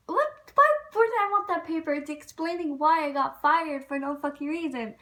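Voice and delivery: high, loud, incredulous comeback; acting out an outraged protest; retold indignation. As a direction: burst out in disbelief, then ease off slightly. Retold angry dispute over being fired.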